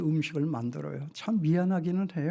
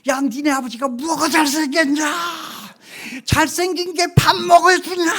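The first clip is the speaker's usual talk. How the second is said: much higher and louder, a shrill scolding shout; mother's yell; 12 semitones higher, 11 dB louder than usual. Retold or imitated. Mother yelling at her teasing son about his father, loud shout. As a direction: blast it out like a fed-up mother. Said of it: "그냥 소리를 퍽 지르면서 이렇게 얘기해요".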